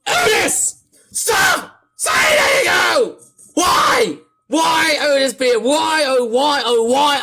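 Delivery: loud, exasperated real shouting; extremely mad. Real, not acted. Streamer raging at Otis over the game, extremely mad. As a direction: rage at full volume, stretching out the repeats. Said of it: "sounding so extremely mad".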